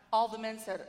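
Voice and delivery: usual speaking voice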